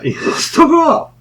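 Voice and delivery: high, slow words squeezed out through laughter; cracking up at the punchline; laughing. Real, not acted. Laughing about the scared neighbor, voice squeaking high and slow.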